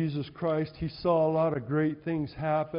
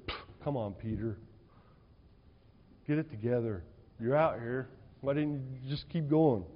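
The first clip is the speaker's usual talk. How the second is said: mock impatient Jesus; 2 semitones lower, 5 dB quieter than usual. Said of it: "No, that's not what he said".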